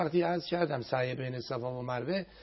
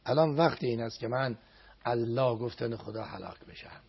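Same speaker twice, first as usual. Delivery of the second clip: ordinary delivery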